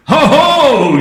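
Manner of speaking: loud high gloating laugh; mocking ho ho. Grandma gloating over the cup, loud mock laugh. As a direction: gloat at catching them. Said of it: "she said".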